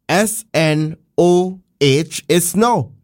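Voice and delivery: S N O H letter by letter, ending on snow like the weather word; correct pronunciation. Shown as a demonstration. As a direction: spell out S N O H slowly, then say snow. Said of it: "the correct pronunciation is".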